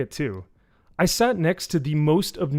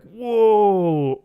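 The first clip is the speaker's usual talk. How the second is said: drawn-out high, loud whoa; mock younger audience; 8 semitones higher, 8 dB louder than usual. Younger audience watching the show, mock awestruck. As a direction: gape in put-on awe. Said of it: "the younger audience is just gonna be like".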